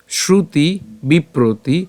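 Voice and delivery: "শ্রুতি বিপ্রতি" slowly, shru-ti bi-pro-ti; careful Sanskrit pronunciation. Shown as a demonstration. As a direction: draw out each syllable of "শ্রুতি বিপ্রতি". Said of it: "উচ্চারণটা কেমন হচ্ছে". ("শ্রুতি বিপ্রতি" ধীরে ভেঙে উচ্চারণ)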